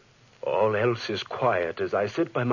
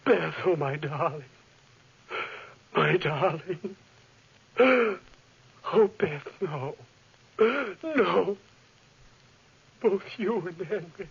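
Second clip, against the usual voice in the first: grief-stricken; 6 semitones higher, slower than usual. Acted. Finds Beth dead, grieving.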